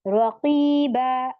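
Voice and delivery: "रकीबा" drawn out, n replaced by long aa; very slow "रकीबा", held open on the last vowel; one-zabar stop. Shown as a demonstration. Teacher showing the verse-end stop, slow "रकीबा" ending in long aa. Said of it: "एक जबर दे देंगे".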